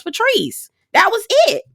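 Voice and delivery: Patrice squeaked up high; mock-incredulous squeal; high sassy punchline. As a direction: pitch it way up on the name. Retort ends on a high note.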